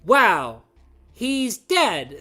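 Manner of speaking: bored flat line reading; deadpan. Retold or imitated. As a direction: say it with zero emotion. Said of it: "deadpan voice acting".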